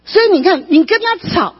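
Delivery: high-pitched, forceful exhortation; impassioned preaching. Real, not acted. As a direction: drive the lesson home to the congregation. (高声激昂地劝勉会众)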